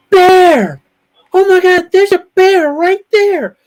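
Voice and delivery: high, loud panicked bear alarm; hammed-up shriek of alarm; Cuppy freaking out. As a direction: imitate someone screaming that a bear is right there. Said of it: "Did you see Cuppy freak out".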